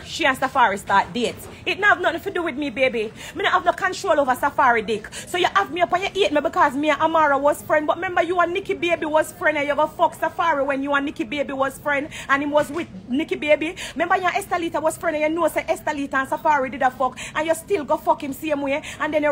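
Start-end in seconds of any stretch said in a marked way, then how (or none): none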